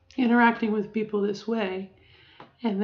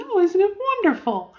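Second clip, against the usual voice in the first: much higher, louder gushing voice; too-high feminine voice; 11 semitones higher, 6 dB louder than usual. Shown as a demonstration. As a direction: push the pitch up to a gushing extreme. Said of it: "a higher voice".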